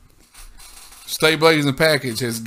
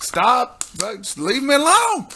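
exasperated; 7 semitones higher, slower than usual. Retold or imitated. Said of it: "I was like".

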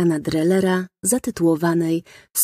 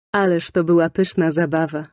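ordinary delivery, nothing marked

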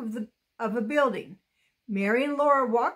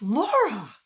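gasped; 3 dB louder than usual. A narrator's reading. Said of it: "Mary gasped".

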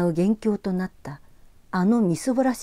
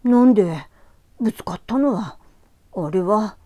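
put-on flustered boy; 3 semitones higher, 7 dB louder than usual. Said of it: "目を白黒させた".

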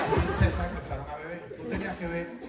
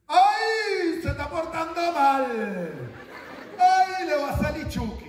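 high, louder fussy parent warning; mock scolding parent; 10 semitones higher, 4 dB louder, slower than usual. Father scaring his daughter with Chucky, high fussy warning. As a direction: scold the kid with a threat of Chucky. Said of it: "Yo dije, "Mira".

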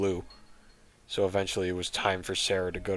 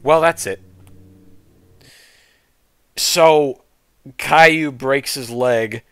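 higher, louder incredulous recap; exasperated rant; 7 semitones higher, 8 dB louder than usual. Real, not acted. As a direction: rant in disbelief. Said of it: "reader recaps in disbelief".

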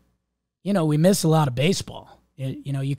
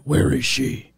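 Batman voice. Retold or imitated. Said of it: "I got some Batman".

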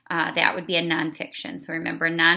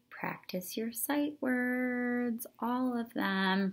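slow, soft and higher, coaxing; gentle urging; 6 semitones higher, 6 dB quieter, much slower than usual. Real, not acted. Teacher gently coaxing students to practise all their sight words. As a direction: coax them kindly to practise every word.

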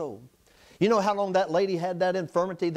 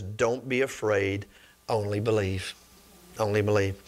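gentle reassurance; 8 semitones lower than usual. Acted. Jesus comforting Jairus, softly.

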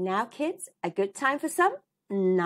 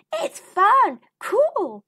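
excited; 13 semitones higher, 9 dB louder, much slower than usual. Acted. Sid excited inside the blanket cave.

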